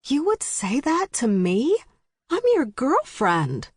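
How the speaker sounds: high, indignant disbelief; shocked. A narrator's reading. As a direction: act wounded and shocked. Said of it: "mouth dropped open in shock".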